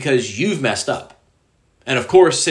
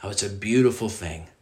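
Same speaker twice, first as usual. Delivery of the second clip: ordinary talk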